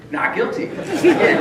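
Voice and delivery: high, slow, laughing over the words; laughing disbelief. Real, not acted. Telling the audience of a second loss, laughing.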